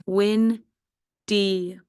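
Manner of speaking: WIN-DY in two separate beats; teacher's slow syllable split of "windy". Shown as a demonstration. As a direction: say Win-dy with a long gap after "win". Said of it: "two-syllable word".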